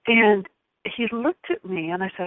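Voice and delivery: ordinary talk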